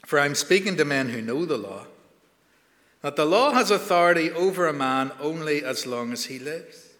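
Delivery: measured, solemn Bible reading; scripture reading. Acted. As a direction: read the passage steadily, like a lector. Reads scripture before sermon.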